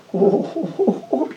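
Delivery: high wailing sobs; imitated sobbing. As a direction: cry out the sobs of a broken old man. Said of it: "και έκλαιγε ο άνθρωπος".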